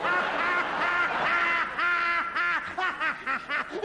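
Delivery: long, high, staccato put-on laughter; hysterical laughing. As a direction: laugh helplessly like a child after a prank. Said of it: "you piss yourself a laughing".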